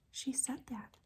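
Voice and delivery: soft whispered question. Real, not acted. Reader asking children a question, whispered. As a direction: whisper it to the little ones. Teacher asks children quietly.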